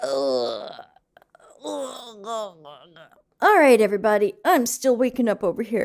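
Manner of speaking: talking through a yawn; sleepy, mid-yawn voice. Real, not acted. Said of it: "I yawn all day".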